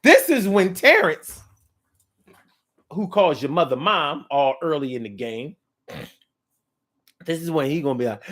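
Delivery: ordinary talk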